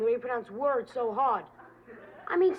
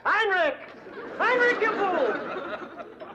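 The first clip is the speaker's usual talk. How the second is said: exasperated call; 7 semitones higher, much slower than usual. Acted. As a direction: yell his name, fed up. Calling Heinrich, annoyed.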